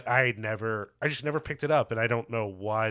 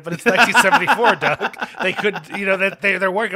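louder and higher, laughing through it; laughing protest; 7 semitones higher, 9 dB louder than usual. Real, not acted. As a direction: laugh as you plead the obvious. Laughing defence of 1974 lineup.

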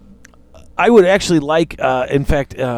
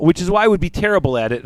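ordinary delivery

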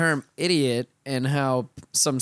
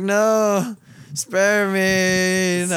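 louder, higher mock plea for mercy; mock pleading; 6 semitones higher, 6 dB louder, much slower than usual. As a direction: beg to be spared, half joking. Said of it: "And I was like".

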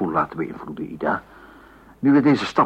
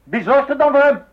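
much higher, loud angry question; angry shout; 12 semitones higher, 6 dB louder than usual. Acted. Husband shouts at his father-in-law in anger. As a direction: hurl the question at him.

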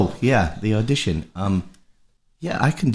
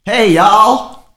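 loud, high, badly faked British accent; a wildly off attempt at a British accent; hammed-up fake British accent, much higher and louder; 10 semitones higher, 9 dB louder, slower than usual. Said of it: "let me try my British accent".

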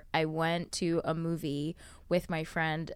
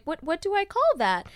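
confused stranger; 10 semitones higher, 3 dB louder than usual. Retold or imitated. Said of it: "they'll be like".

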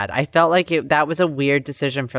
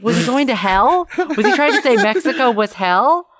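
ordinary talk, nothing marked

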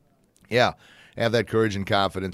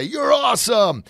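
high, loud, drawn-out cheer; riled-up cheering; 12 semitones higher, 5 dB louder, slower than usual. Shown as a demonstration. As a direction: shout praise like pumped-up kids. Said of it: "look to their teachers and say".

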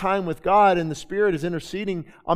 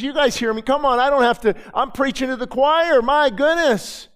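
ordinary talk, nothing marked

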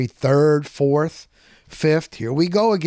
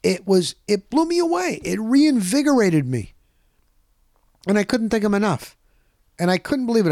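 amazed gushing; 6 semitones higher than usual. Real, not acted. Podcaster still overwhelmed by his friend's welcome, amazed gushing. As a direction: pitch soaring with real wonder. Gushing over Joe's hospitality.

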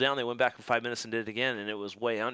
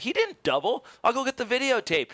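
arguing; 11 semitones higher, 3 dB louder than usual. Retold or imitated. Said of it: "I'm like".

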